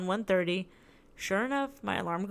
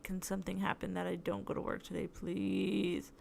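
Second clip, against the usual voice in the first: sad pleading prayer; 9 dB quieter than usual. Retold or imitated. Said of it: "sadly praying".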